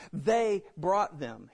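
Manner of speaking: "They" drawn out and punched, slow delivery; stress on THEY. Acted. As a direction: hit "They" hard and slowly to shift blame. Saul quoted shifting the blame to others.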